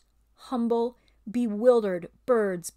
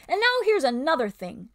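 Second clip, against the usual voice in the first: loud, higher, pushy ape; ape's bullying voice; 6 semitones higher, 6 dB louder than usual. Acted. Ape lectures the beasts, loud and bullying. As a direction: announce it like a sly bully.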